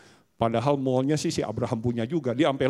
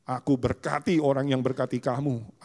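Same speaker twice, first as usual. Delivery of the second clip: ordinary talk, nothing marked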